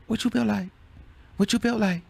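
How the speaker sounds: high, even mimicry of a rapper; calm rapper impression. Showing how the rapper said it, calm and high. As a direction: repeat it coolly, like the rapper. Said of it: "That's how he said it".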